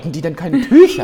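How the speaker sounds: laut, durch Lachen gesprochen; spöttisch lachend fragen. Real, not acted. Lachend ungläubige Nachfrage.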